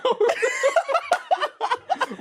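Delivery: real laugh breaking out high; cracking up at the bird story; high burst of laughter. Real, not acted. Laughing at her own bird paranoia.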